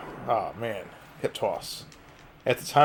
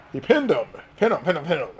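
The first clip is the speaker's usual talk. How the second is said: excited urging; 6 semitones higher, 6 dB louder than usual. Real, not acted. Urging a pin during match.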